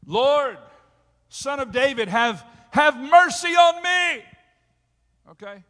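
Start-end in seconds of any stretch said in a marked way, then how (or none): 0.1-4.2 high, loud, pleading shout for mercy; desperate cry. Acted. A desperate mother shouting to Jesus for mercy, high loud plea. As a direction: cry out to the Lord, begging at full voice. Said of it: "She, she cries out".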